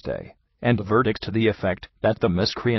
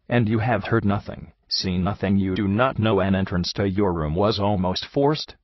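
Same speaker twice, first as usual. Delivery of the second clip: ordinary delivery, nothing marked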